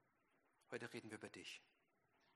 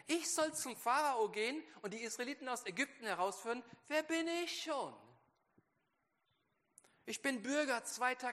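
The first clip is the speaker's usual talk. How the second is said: self-pitying Moses; 13 semitones higher, 11 dB louder than usual. Acted. Moses answering God, whining that he is unworthy. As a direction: sound small and full of excuses. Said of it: "antwortet voller Minderwert".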